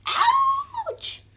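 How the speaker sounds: very high pained squeak; high squeal ouch. Acted. Douglas hitting the ground, high yelp.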